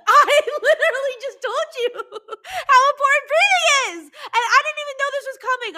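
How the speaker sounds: high, loud laughing talk. Real, not acted. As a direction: crack up while speaking. Laughing at own prediction.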